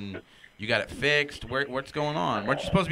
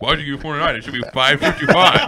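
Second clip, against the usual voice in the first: loud, laughing greedy complaint; mock demanding; 11 dB louder, faster than usual. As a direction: tease him as a greedy haggler. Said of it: "he's like".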